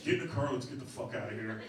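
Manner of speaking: low, hushed order; acted quiet, subdued command; suddenly quiet. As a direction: drop it down low and soft. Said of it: "it gets real quiet, and he's like".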